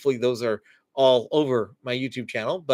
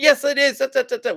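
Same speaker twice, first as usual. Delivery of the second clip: mock yell, much higher and louder; child yelling; 9 semitones higher, 5 dB louder than usual. Upset child yelling at the parent, mock yell. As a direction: snap it back like an upset kid. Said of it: "they might yell at us".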